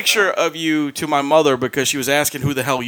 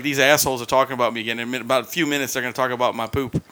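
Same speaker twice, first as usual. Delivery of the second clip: lower, softer voicing of her thoughts; imagined annoyed thoughts; 6 semitones lower, 7 dB quieter, faster than usual. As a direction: mutter her imagined complaint, lightly acted. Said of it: "She's looking at you like".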